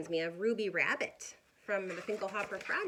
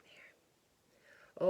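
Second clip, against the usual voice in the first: ordinary delivery, nothing marked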